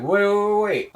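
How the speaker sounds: high, loud repeated wait; urgent calling out. Retold or imitated. Protagonist calling his friend back, urgent loud cry. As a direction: shout to stop him before he goes.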